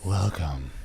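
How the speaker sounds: low, soft host's welcome; put-on deep podcast voice. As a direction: drop it down for a mock podcast welcome. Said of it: "Give me your best podcast voice".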